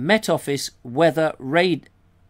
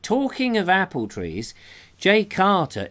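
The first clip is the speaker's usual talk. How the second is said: ordinary talk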